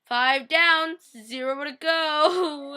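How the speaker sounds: high, loud, drawn-out mock wail; sad. Fan counting the deaths after John's, sad drawn-out wail. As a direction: stretch it out mournfully, tearful at the end. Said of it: "And I was so sad".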